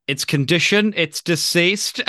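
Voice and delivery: DECEASED drawn out, rising like a question; slow, high "deceased", lifting in bewilderment at the end; bewildered questioning. Retold or imitated. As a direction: imitate a baffled reply, pitching "deceased" up as a question. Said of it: "His voice goes up like it's a question".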